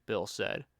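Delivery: usual speaking voice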